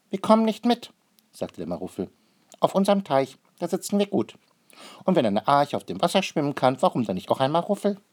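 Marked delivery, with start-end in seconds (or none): 0.1-0.9 high, loud character voice; stubborn creature's refusal. A narrator's reading. Maruffel refuses to board Noah's ark, high and stubborn. 2.6-8.0 high, loud creature voice; stubborn little pond creature, arguing. A narrator's reading. Maruffel defends staying on the pond, high and stubborn.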